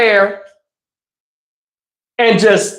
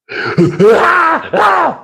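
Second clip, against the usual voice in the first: imitated wail; 8 semitones higher than usual. Brother's cry imitated.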